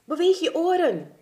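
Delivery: high, loud order; commanding. Acted. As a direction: command it with sudden urgency. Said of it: "commandeerde Langaneki".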